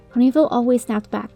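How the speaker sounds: plain reading voice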